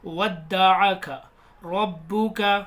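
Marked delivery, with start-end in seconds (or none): 0.1-1.1 वद्दअका with the doubled द given a sharp jolt; slow, hit the doubled द in वद्दअका hard; वद्दअका said slowly, pressing the shadda. Shown as a demonstration. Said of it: "थोड़ा झटका देकर".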